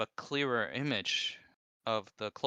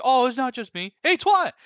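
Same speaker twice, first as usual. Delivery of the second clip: very high, loud mock shout; Will calling out; 10 dB louder than usual. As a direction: yell it across the room as a joke. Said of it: "He's like".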